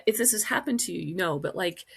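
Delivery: ordinary talk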